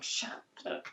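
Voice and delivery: low-volume, whispered telling-off; hushed scolding. Retold or imitated. Wife shushing husband during smudging, hushed. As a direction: shush him under your breath. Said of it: "I'm like".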